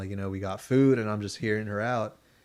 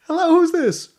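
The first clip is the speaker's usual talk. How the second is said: much higher, louder radio host greeting; mock DJ pickup, much higher and louder; 9 dB louder than usual. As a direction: put on the perky on-air answer. Replaying the radio DJ answer.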